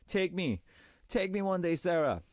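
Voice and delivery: high, eager plea; playful pleading. Real, not acted. DJ asking a listener to take them to Disneyland, playful pleading. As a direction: beg playfully to be taken along.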